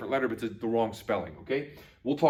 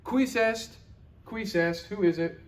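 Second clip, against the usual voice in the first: mild answering the door; 9 semitones higher than usual. Acted. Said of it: "what would you say?".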